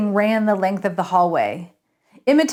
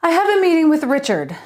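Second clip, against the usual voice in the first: Lance speaking; 7 semitones higher, 5 dB louder, faster than usual. A narrator's reading. Narrator voices Lance at reception.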